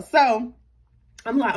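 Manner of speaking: normal talking voice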